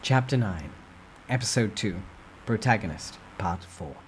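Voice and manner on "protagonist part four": ordinary delivery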